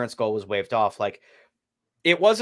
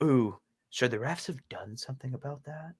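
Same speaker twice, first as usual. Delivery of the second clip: hushed, lightly voiced broadcaster musing; a soft mock-TV-commentator aside; 8 dB quieter than usual. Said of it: "they're sitting here going like".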